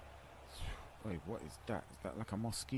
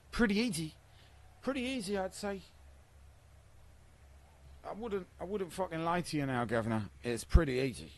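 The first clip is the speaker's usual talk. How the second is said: hammed-up cockney geezer, pitched higher; mock cockney accent; 9 semitones higher than usual. Gamer mocking how others hear his cockney accent. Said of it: "that's what they think we sound like".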